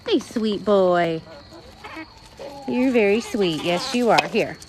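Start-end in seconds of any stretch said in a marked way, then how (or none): none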